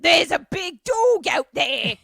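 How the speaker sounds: high, loud ghoul shriek. Retold or imitated. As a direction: screech it like a cartoon ghoul. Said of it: "a ghoul voice".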